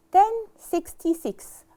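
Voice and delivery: slow model reading. Shown as a demonstration. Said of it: "I'll divide the year".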